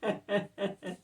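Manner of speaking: laughing through the words; real laughing. Real, not acted. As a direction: giggle helplessly at the card as you read it. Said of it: "So I'm laughing".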